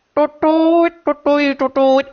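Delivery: hooting a bamboo flute melody with the voice; mimicked flute tootle. Playing the kabayan flute for Bang, vocal flute toots. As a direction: toot like a Sundanese flute, light and playful. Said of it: "seluring kabayan".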